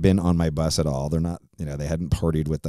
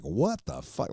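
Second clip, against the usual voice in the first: disbelief; 7 semitones higher, 7 dB quieter, slower than usual. Retold or imitated. Shocked at their success.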